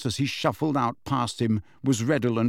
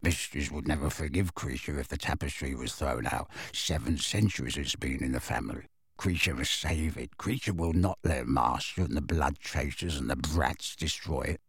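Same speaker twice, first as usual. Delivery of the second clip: low, soft, hurried mutter; very fast mutter; 8 semitones lower, 7 dB quieter than usual. A narrator's reading. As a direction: rattle it off under the breath. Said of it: "muttered very fast".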